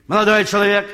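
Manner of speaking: громкий окрик вдогонку; крикнуть прохожему в спину; крик швейцара. Retold or imitated. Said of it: "Швейцар кричит". (кричит вслед уходящему)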